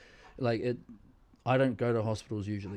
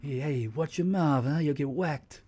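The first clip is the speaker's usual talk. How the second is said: slow Italian-American mobster threat; a mock wiseguy warning; mafia guy; 4 semitones higher than usual. Teasing the nurse, mock mafia threat. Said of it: "kinda sound like a mafia guy".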